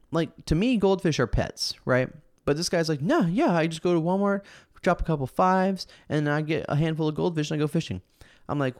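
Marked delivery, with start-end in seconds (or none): 3.0-8.0 casual stranger. Retold or imitated. Acting out the goldfish stranger, casual.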